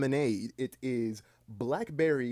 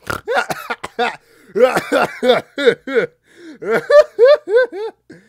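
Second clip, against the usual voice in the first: loud high laugh with a snort; wheezing snorting laughter; 18 dB louder than usual. Real, not acted. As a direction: let the snort-laugh rip. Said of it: "I snorted".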